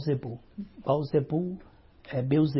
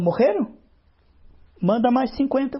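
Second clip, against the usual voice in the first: higher, louder outraged king; the king can't believe it and orders more; 8 semitones higher, 5 dB louder than usual. Acted. King learns his soldiers died, higher louder outraged order.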